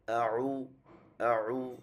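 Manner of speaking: আউ said with rounded lips from the throat; আউ the right way, lips round; correct pronunciation. Shown as a demonstration. Teacher showing the correct আউ after the wrong one, rounded lips. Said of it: "এটা হবে".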